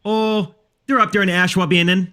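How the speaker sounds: playful, hammed-up Minnesota accent. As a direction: reprise the upper-Midwest Minnesota accent. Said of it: "That accent is Minnesota".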